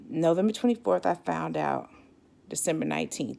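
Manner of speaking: ordinary talk, nothing marked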